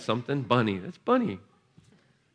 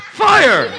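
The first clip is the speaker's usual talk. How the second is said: high, loud shout of fire; 12 dB louder than usual. Real, not acted. Preacher shouting fire over a child, high and loud. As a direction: fire it out hard.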